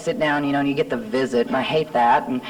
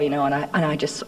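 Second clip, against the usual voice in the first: ordinary delivery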